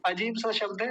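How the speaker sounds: ordinary talk, nothing marked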